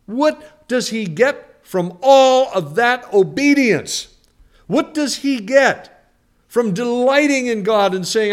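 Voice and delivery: loud, high-pitched sermon build; full-force pulpit preaching; fiery preaching. Real, not acted. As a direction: preach it hard to the congregation.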